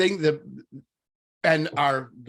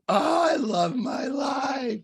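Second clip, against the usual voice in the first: high, slow, swooning sing-song; mock blissful gush; 11 semitones higher, slower than usual. Mocking the idea that chores feel joyful, a swooning high gush. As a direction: gush it like a dreamy ad for perfect family life.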